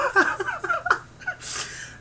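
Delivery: real laugh breaking very high. Real, not acted. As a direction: laugh at his own failed accent. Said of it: "I don't think I can do it that well".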